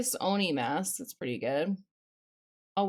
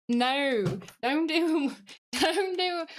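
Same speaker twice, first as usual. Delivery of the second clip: put-on Australian-Southern accent; 5 semitones higher, much slower than usual. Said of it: "I'm trying to do, like, a Australian mixed with a Southern accent".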